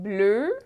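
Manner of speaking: "bleu" with a held closed eu; closed vowel example. Shown as a demonstration. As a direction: model "bleu" with a tight "er"-like ending. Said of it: "like in".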